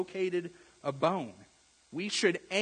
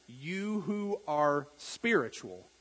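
"You who are spiritual" said slowly, each word weighted; slow, deliberate quote; slow emphasis; slower than usual. Shown as a demonstration. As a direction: lean on "You", drawn out. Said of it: "it said".

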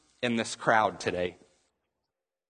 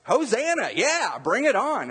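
high, drawn-out mock cheer; imitated cheering crowd; 10 semitones higher, slower than usual. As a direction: cheer along with overdone zeal. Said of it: "there's people that they're doing".

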